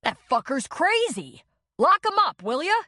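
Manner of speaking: high, loud outraged plea; outraged demand. A narrator's reading. Bloodied man yelling to the cop, outraged demand. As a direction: yell for the cop to lock him up. Demanding arrest mid-fight.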